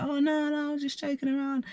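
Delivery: high-pitched mock innocent protest; mock backtracking girl. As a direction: put on a high voice to play down the offence. Voices the girl backing down.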